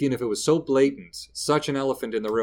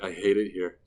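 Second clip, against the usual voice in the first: mock despair; 4 dB louder, much slower than usual.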